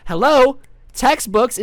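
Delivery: loud, high, demanding substitute; imitating an impatient teacher; mock impatient calling. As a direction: call the class to attention, acted impatience. Substitute snapping for attention.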